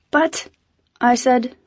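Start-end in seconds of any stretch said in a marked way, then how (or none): 0.1-0.5 sharp. Acted.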